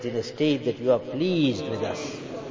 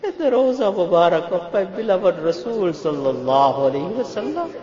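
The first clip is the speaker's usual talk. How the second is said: tearful supplication; 7 semitones higher, 4 dB louder than usual. Real, not acted. Emotional plea in prayer.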